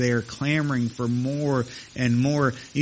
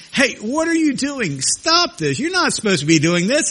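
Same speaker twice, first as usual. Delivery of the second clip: imitated yelling; 9 semitones higher than usual. Holy Spirit warning someone caught doing wrong, mock yelling. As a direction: call out a sharp warning in a raised pitch. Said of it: "Holy Spirit of God is just yelling".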